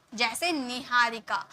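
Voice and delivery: ordinary talk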